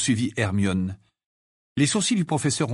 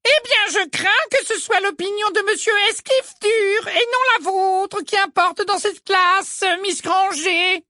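stern Umbridge; 6 dB louder than usual. A narrator's reading. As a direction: put the pupil in her place. Ombrage voiced by narrator. (voix aiguë, lente et sèche)

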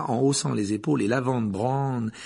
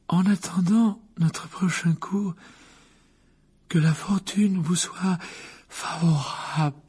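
faint voice; 7 semitones higher, slower than usual. Acted. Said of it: "d'une voix faible".

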